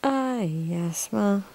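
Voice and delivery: ordinary talk, nothing marked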